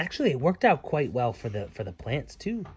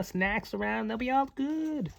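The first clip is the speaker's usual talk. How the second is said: plain reading voice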